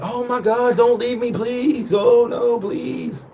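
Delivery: high, drawn-out hammed-up begging; mock pleading. Boyfriend begging her not to leave, mock pleading. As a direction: wail it theatrically, badly faked heartbreak.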